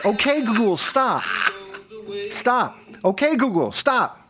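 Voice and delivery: high, laughing repeated commands; frantic, laughing stop commands; repeating to voice assistant. Real, not acted.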